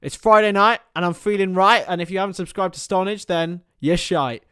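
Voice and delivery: loud, sing-song channel-intro rhyme, slowing on the last line; rhyming promo intro. Acted. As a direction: belt it out like a cheeky channel jingle.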